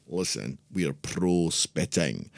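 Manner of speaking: put-on strong Scottish accent. Podcaster joking that the show backs spitting, Scottish accent. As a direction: put on a lightly done strong Scottish brogue. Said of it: "in that particular strong Scottish accent".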